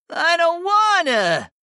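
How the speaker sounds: put-on high, drawn-out childish whine; pouting cat. A narrator's reading. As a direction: whine loudly and slowly, refusing. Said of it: "Dio pouted".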